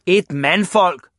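loud, slow emphatic shout. A narrator's reading. Father answering Ivan's question, slow shouting. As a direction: hammer out the word indignantly. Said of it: "råbte hans far".

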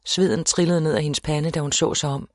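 usual speaking voice

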